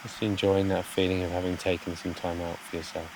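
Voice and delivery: soft, unhurried meditation voice with long pauses; guided meditation. Real, not acted. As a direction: gently guide listeners to settle, phrase by phrase.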